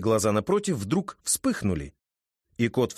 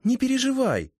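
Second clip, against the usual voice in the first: light, smiling high voice; a smiling young witch; high female voice; 14 semitones higher than usual. A narrator's reading. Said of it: "улыбнулась Кикимора".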